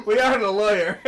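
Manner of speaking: loud, high words bursting through laughter; helpless laughing realization; real laughter. Real, not acted. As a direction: crack up while saying it. Cracking up at their mistake.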